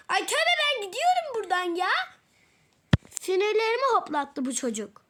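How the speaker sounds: mock fed-up outburst; exasperated outburst. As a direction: storm off in a huff. Fed up, storming off.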